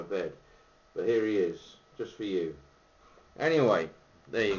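talking around pipe stem; clenched pipe speech; pipe in mouth. Real, not acted. Seen 1: Pipe clenched in mouth corner throughout; held in hand in refs.

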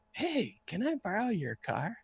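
high, soft spaced-out request; drunk dude. Retold or imitated. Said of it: "acted like a drunk dude".

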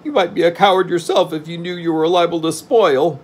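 high, loud indignant character voice; acted high-pitched indignation; angry retort. A narrator's reading. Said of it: "retorted Jack angrily".

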